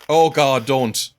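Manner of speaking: sudden loud "don't!" in alarm. Real, not acted. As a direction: blurt it out, startled. Alarmed at character's jump.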